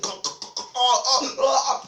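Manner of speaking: choking, gargled cries, rising high and loud; mock spluttering and yelling; sputtering dad. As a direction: splutter as if sprayed in the face.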